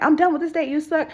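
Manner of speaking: high, loud mock outburst; blunt rejection. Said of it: "I could've been like".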